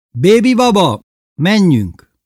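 high, loud summons; calling out. A narrator's reading. Calling Bébi down the valley, loud high call. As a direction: shout across to get moving.

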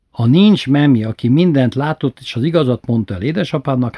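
ordinary delivery, nothing marked

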